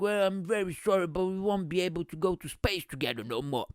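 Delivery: mock British accent, apologetic; Richard Branson. Branson apologising about the space trip, mock British accent. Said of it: "And then he'd be like".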